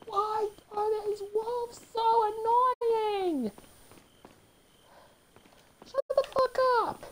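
high, drawn-out wail of frustration; furious whine. Real, not acted. Player fed up with wolves, furious high whine. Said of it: "I'm angry, I'm totally furious".

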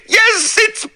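high, loud, sobbing, building to a shriek; hysterical confession. Acted. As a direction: confess in wild terror.

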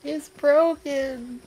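high, slow hammed-up wail; mock despair. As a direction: stretch it out, dramatic.

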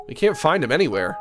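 ordinary delivery

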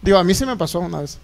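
ordinary talk, nothing marked

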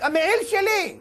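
very high mock yell; retold shout. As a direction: imitate a father crying out his claim. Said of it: "ואבא צועק".

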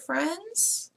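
plain reading voice

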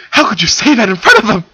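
high, loud and fast flustered yell; embarrassed yell. Acted. Silver yelling at Moltar for teasing her in front of the princesses. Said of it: "she yelled at him".

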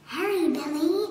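ordinary delivery

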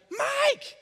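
a sudden high yell of his name in alarm; panicked yell. Retold or imitated. Wife calling her husband from downstairs in a panicked high yell. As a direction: shriek his name up high like something's wrong. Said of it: "that's like a panicked yell".

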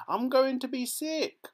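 high, loud wailing complaint; queasy yell. A narrator's reading. Mrs Teavee yelling in the swerving lift, high and queasy. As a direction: wail it as the lift lurches. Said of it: "yelled Missus Teavee".

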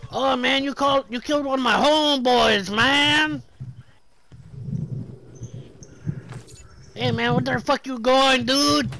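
high, loud put-on street confrontation; aggrieved homeboy. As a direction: wail the accusation in a hammed-up high voice.

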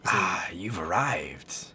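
low breathy villain whisper; menacing game baddie, hushed and slow. Voicing game villain's lines.

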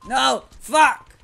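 loud, high anguished yell; real outburst. Real, not acted. Gamer watching his character die, loud anguished yell. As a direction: cry out as the character dies. Dying in game, cries out.

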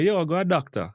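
Jamaican patois, lifted and bright in pitch. Shown as a demonstration. Teacher quoting how Jamaicans say it in Jamaican. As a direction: say it like a local Jamaican. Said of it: "you hear a Jamaican say".

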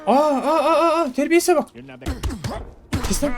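high, half-laughing outraged squeal; hammed-up shock at a rude prisoner; mock outrage. Guard squealing in mock shock at a rude prisoner.

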